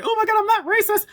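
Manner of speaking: mocking a flustered coworker in a high, fast squeal; shrill mock-panic, high and rushed; flustered denial. Mimicking the flustered lead denying racism, high, fast and panicky. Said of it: "they get all flustered".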